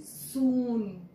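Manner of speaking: teacher contrasting long oo in Soon; stressed long oo. Shown as a demonstration. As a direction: stretch the oo in Soon. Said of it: "Let me compare it again".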